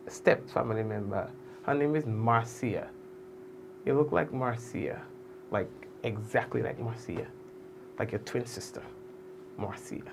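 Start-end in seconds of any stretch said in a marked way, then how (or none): 1.7-10.0 soft, low delivery. Shown as a demonstration. Host tells Adonna about a lookalike relative, softly. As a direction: drop low and soft over the name. Gently models the name, very soft.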